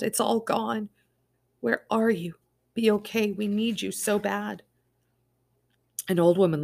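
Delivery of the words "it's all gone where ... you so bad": higher, pleading wail of loss. Acted. Summer wailing for Dash amid the wreckage. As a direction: cry out for her missing husband. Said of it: "she wailed".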